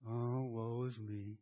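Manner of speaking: hammed-up woe is me; mock self-pity. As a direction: sigh it out like a sulking martyr. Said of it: "I find myself sometimes".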